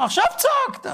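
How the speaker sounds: asking the dancing man why he now dances, high squeak; mocking questioner. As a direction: play the puzzled onlooker in a high squeal. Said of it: "שאל אותו".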